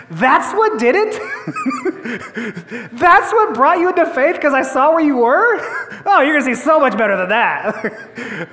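amused, high-pitched and laughing through the words; laughing Jesus. Acted. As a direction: laugh kindly at his small faith. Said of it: "Jesus laughs at him".

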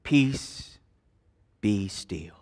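soft, hushed whisper of Peace; Jesus gently hushing the sea. Shown as a demonstration. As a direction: breathe Peace out softly, almost unvoiced. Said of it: "as some translations put it".

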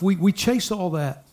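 ordinary talk, nothing marked

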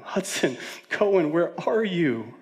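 put-on calling for children. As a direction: re-enact a parent calling out for lost kids. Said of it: "I was calling for them".

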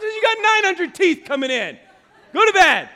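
high, loud exasperated dad outburst; mad yelling. Retold or imitated. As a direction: re-enact shouting a kid back to bed. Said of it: "I got mad".

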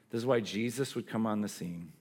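usual speaking voice

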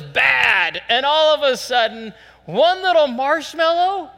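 high scolding rant building to loud; mock exasperation. Farmer scolds pigs lured by one marshmallow, incredulous.

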